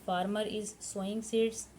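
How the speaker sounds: very slow, word by word for students to write; slow, careful dictation pace. Shown as a demonstration. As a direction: spell it out slowly for the class to copy. Teacher dictating English sentence.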